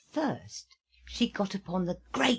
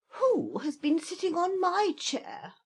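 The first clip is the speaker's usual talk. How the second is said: much higher, mid bear voice; middling-sized voice; 12 semitones higher than usual. Acted. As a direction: middle bear, a bit indignant. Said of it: "cried in a middling-sized voice".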